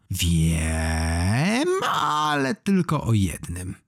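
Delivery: slow, stretched vampire hiss; drawn-out, wary creature voice; quiet hiss. A narrator's reading. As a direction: hiss it suspiciously, dragging the words. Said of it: "syknął cicho".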